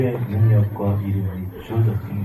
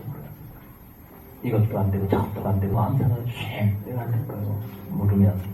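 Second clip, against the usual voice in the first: ordinary talk, nothing marked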